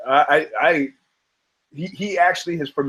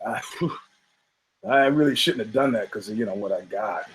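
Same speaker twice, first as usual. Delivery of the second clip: a hint of a lower, rueful horse; lightly voiced horse; 5 semitones lower, 6 dB quieter than usual. Joke horse at the bar, lightly voiced and lower. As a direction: play the horse, lower and sheepish. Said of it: "The horse goes".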